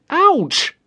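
sudden high yelp of pain; pained cry. A narrator's reading. Boy hit on the shoulder by a rock, high yelp. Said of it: "cried Billy".

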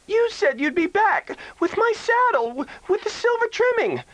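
high, pert little-sister voice; a scheming kid sister arguing her case; little girl voice. Acted. Kid sister scolds her brother about his war bag, high and pert. Oda Lee voiced by narrator.

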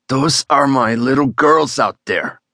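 slow, forced through clenched teeth. Acted. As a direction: squeeze each word out in pain. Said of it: "Juan managed through clenched teeth".

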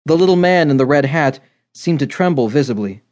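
ordinary delivery

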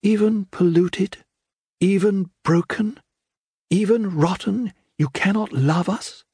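put-on high, earnest young girl's voice; pleading daughter Faith. Faith pleads with her father.

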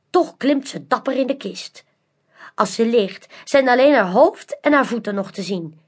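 usual speaking voice

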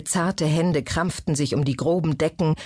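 plain reading voice

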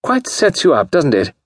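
high-pitched giggly socialite; high, silly society voice; giggly lady. A narrator's reading. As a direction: say it high and girlish, without laughing. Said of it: "She giggled".

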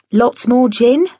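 chirpy, as if being useful; helpfully. Acted. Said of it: "I said, helpfully".

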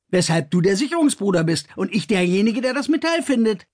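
young man taunts his older safety brother, defiant and mocking; Kors, defiant. A narrator's reading.

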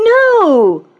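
high, loud, panicked cry; screamed no. A narrator's reading. Frightened man hearing he will be fired, screaming. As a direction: scream it in sudden terror. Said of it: "He virtually screamed it".

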